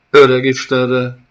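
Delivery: loud, high alarmed cry; sudden shout. Acted. Nomad sees the golem move and yells out loud. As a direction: burst out in alarm. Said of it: "Kiáltotta hirtelen".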